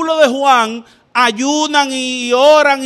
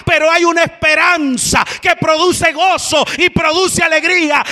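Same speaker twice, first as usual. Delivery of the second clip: higher, shouted cadence building to hope; preaching at full force; 6 semitones higher than usual. Acted. Preacher proclaiming scripture to the church, in a rising shout. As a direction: hammer each line then lift to triumph.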